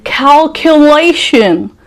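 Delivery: slow, high, stretched "Calculation" in a fake accent; exaggerated foreign accent on Calculation; put-on accent. Shown as a demonstration. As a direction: drag Calculation out in an affected accent. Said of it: "Again, accent".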